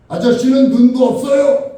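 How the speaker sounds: high, loud, annoyed retort; irritated shout. Retold or imitated. Woman snaps back at the man instead of apologizing, irritated shout. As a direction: yell back with sharp annoyance. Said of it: "짜증 섞인 목소리로".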